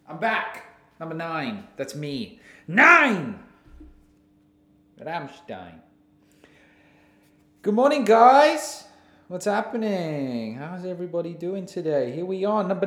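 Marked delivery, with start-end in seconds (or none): 2.7-3.4 loud, falling shout of Nine; shouted number. Real, not acted. 7.6-8.8 hammed-up greeting.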